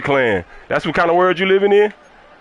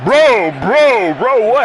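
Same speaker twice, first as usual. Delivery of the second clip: yelling bro; 5 semitones higher, 5 dB louder, much slower than usual. Real, not acted. Streamer yelling at opponent mid-game.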